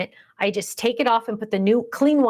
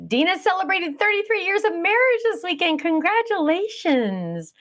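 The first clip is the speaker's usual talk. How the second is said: high, sing-out congratulations; delighted congratulations; 9 semitones higher than usual. Real, not acted. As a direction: celebrate their news, pitched way up. Congratulating a viewer on anniversary.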